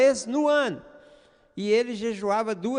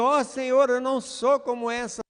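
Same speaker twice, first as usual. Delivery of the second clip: louder, higher voice imitating someone praying aloud; mock loud prayer; 5 semitones higher, 4 dB louder than usual. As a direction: call out a showy prayer, mildly. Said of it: "orando em voz alta, gritando e dizendo".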